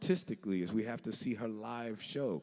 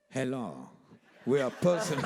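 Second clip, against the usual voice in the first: mock-grand affected voice; imitating the star's affectation; 3 semitones higher, 6 dB louder than usual. Young musicians at the club's back door, affected imitation. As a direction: put on a borrowed diva accent. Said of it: "we thought that that was her normal speaking voice".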